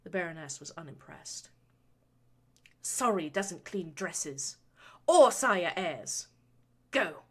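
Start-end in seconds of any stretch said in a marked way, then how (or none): 2.8-7.2 slow, cutting and louder; cold order. A narrator's reading. Baroness coldly dismissing her son's apology. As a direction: drag out each word with contempt. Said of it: "she ordered".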